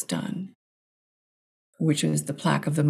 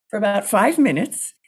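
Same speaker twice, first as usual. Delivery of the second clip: ordinary delivery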